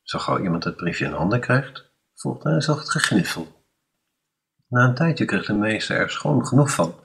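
plain reading voice

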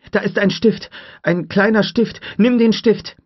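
high, loud frantic order; urgent shout. A narrator's reading. Tim urging Lex to grab the pin, urgent shout. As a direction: yell the instructions.

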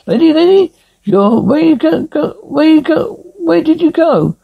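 high calls, searching for someone; rising calls for the lady; calling out. Acted. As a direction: call after her, worried she's gone.

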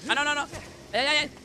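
frantic high-pitched alarm; panicked cries. Real, not acted. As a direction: squeal in panic as you're hit. Panics under attack.

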